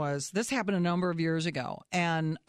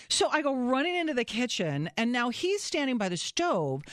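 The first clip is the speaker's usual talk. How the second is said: ordinary talk, nothing marked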